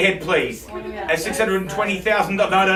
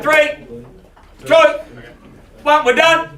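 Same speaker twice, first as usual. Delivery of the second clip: loud, high countdown to the hammer; countdown call; 6 semitones higher, 4 dB louder than usual. Real, not acted. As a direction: count them down to the sale.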